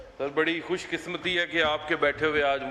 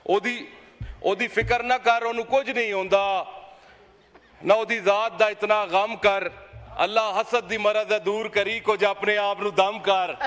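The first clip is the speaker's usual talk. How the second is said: declaimed verse, slightly raised and measured; reciting a Punjabi couplet; 3 semitones higher than usual. Acted. MP recites a couplet at Imran Khan's critics, declaiming. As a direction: recite it like a poet at a mushaira, a touch higher. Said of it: "sir मैं एक शेर पढ़ के".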